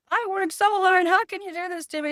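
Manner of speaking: high-pitched, upset mock complaint; retold yelling. Agent complaining to her about the cut commission, high mock upset. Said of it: "she's yelling at".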